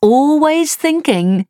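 high, slow playful tease; teasing. A narrator's reading. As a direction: tease him, drawn out and sing-song. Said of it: "said the wife teasingly".

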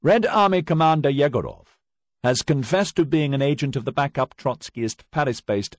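loud, high, formal reading of the charges; stern prosecutor. Acted. Prosecutor reading charges to the accused, loud and formal. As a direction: announce the indictment to the whole court.

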